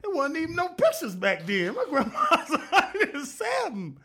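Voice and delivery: high laughing protest; cracking up defending grandma. Real, not acted. Laughing rant about grandma.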